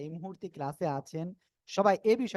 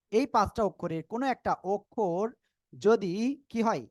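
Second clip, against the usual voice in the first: ordinary talk, nothing marked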